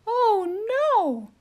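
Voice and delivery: high, startled turkey voice; alarmed turkey. Acted. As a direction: panic at being dinner again.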